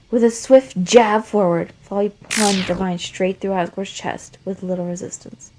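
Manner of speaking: plain reading voice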